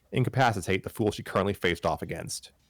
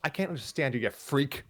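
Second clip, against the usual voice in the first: snarling; 6 semitones higher, 4 dB quieter than usual. Acted. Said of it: "Mary Sue snarled".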